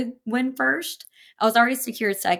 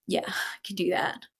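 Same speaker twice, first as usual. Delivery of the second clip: meek whisper; 6 dB quieter than usual. Retold or imitated. Teen replies to dad after crying.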